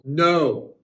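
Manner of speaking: loud, exasperated cop's "no"; mock red-faced anger. Red-faced policeman corrects the driver, loud mock anger. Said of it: "Red-faced policeman".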